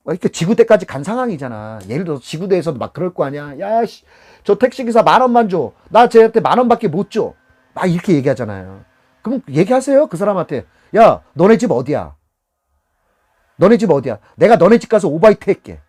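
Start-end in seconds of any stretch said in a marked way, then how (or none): 3.6-7.3 loud, higher dismissive demand; acted-out indignant passenger; belligerent customer. Acted rude customer at police station. 11.0-15.8 loud, confrontational challenge; acted-out heated retort; indignant comeback. Shown as a demonstration.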